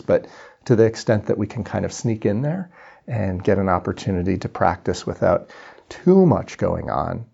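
ordinary delivery

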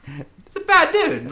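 high, slow mock outrage; high exaggerated. Real, not acted. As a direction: stretch it out up high. Exaggerated emphasis.

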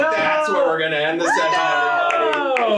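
loud, high, teasing announcement; hyped shout. Real, not acted. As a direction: end the session on a high. GM ends on cliffhanger.

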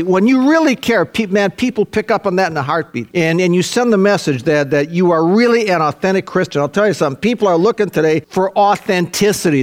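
usual speaking voice

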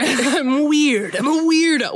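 loud, high, laughing; Riverdale quote. Retold or imitated. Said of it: "it's from Riverdale".

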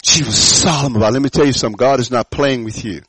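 normal talking voice

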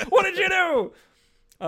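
high, slow, shouted mock accusation; Fantastic Four yelling. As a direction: bellow it in a high cartoonish voice. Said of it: "They're like".

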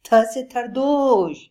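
joyfully mangle the word; happy lisping repeat. Acted. Lion happily repeats the letter, lisping it. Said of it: "शेर खुश होते हुए बोला".

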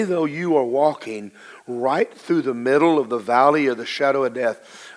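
ordinary talk

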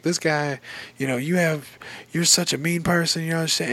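imitating her shrill outburst, half-hammed; retold screaming. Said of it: "starts screaming at him".

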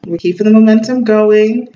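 ordinary talk, nothing marked

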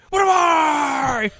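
high, loud, slow mock wail; hammed-up, drawn-out holler; imitated meltdown scream. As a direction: stretch it out at full yell. Imitating Cage's overdubbed meltdown line.

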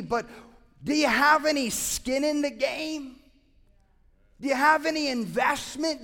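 preaching. Acted. Pastor pressing the church about giving, preaching. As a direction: push the question at the congregation.

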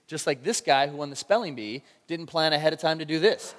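ordinary talk, nothing marked

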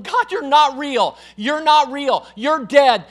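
loud, high taunt thrown at God; imitated mocking. Preacher acting his unsaved self jeering at God, mocking. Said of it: "I would begin to mock God, laugh at God".

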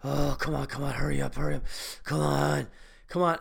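low, creepy urging from a stranger at the door; hammed-up horror-movie pestering; impatient stall lurker. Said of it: "I hear this guy".

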